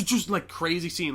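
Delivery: ordinary talk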